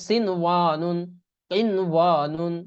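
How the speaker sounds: slowed, stretched recitation; tajweed recitation. Shown as a demonstration. Teacher demonstrates izhar words in slow recitation. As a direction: draw the words out as in Quran reading. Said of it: "এই জন্য এগুলো".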